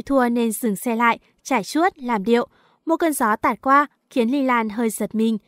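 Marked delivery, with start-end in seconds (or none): none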